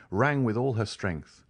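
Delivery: plain reading voice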